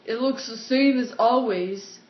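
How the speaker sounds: loud, slow put-on character voice; Matt. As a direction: drag it out loudly in character. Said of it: "Matt's voice".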